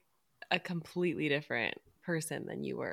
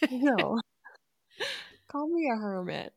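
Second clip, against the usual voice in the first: laughing; 6 semitones higher than usual. Real, not acted. Laughing at hermit mix-up.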